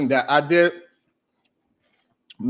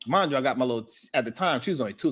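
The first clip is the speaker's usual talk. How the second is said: ordinary talk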